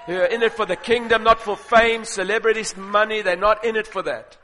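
loud preaching, building in pitch and force; forceful preaching. Real, not acted.

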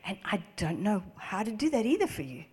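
usual speaking voice

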